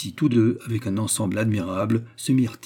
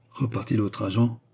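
ordinary delivery, nothing marked

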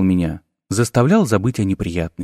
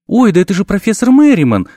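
high, louder joyful cry; delighted exclaim; 8 semitones higher, 3 dB louder than usual. A narrator's reading. Dean recognizing her old friend, high delighted exclaim. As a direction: throw up your hands and cry out with delight. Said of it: "всплеснула она руками".

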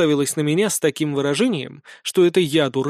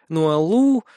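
ordinary delivery, nothing marked

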